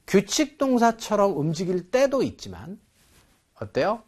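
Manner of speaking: ordinary delivery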